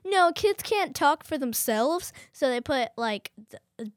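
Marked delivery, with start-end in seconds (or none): 0.0-2.1 higher, slower mock announcement; lightly mocking game-maker voice, pitched up and slowed; voicing Among Us. Kid quoting the game's reasoning, higher slow mock voice. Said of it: "Among Us was all like".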